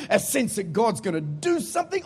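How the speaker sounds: ordinary talk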